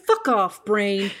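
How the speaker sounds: loud scolding; telling off. Real, not acted. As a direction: shout it at the nagging brain. Telling off her inner critic.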